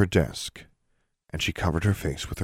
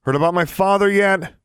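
loud, high-pitched taunting yell; angry shout; 14 semitones higher, 6 dB louder than usual. A narrator's reading. Alexandra challenges the shut doors along her hall, shouting. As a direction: fling it down the corridor at full voice. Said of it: "she shouted".

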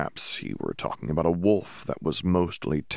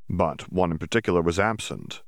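ordinary delivery, nothing marked

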